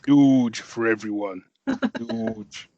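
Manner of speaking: slow, loud advert slogan; perfume ad voice. Pretend. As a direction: sell it like a perfume ad.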